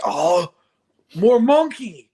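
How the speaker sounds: high, loud delighted cheer; excited cheer. Pretend. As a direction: shout it like a thrilled kid. Mock excited monkey cheer.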